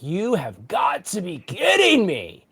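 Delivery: hammed-up disbelief, stretching each word; exasperated disbelief. Reluctant pastor landed in the pulpit, exasperated disbelief. Retells learning he must preach.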